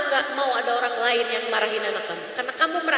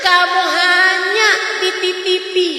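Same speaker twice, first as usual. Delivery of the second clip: forceful preaching; 3 semitones higher, 5 dB louder than usual. Acted. Preacher rebuking mothers, loud and rising. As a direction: hammer each line home to the mothers.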